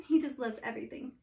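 plain reading voice

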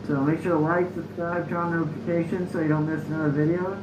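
muffled behind mask; speaking through gas mask. Real, not acted. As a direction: voice through respirator. Seen 5: full-face gas mask with filter worn throughout labelled speech.

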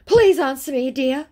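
high, loud, needy imitation; mock pleading. Scammer begging for an answer, mock pleading. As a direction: call out an exaggerated plea.

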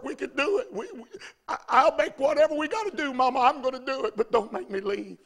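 imitated boy's urgent, stammering plea; pleading nine-year-old. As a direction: plead with mama as a frantic child. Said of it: "I heard the nine-year-old say to his mama".

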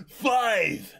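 loud shouted count; countdown shout. Acted. Crowd counting down the villain's KO, loud shout. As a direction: yell the number with glee.

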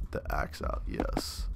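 breathy whisper. Real, not acted. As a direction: whisper so nobody hears. Hushed while sneaking.

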